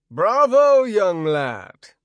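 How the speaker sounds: loud, high mock applause; a bandit chief jeering at his prey; mocking tough guy. Bandit leader hails the hero.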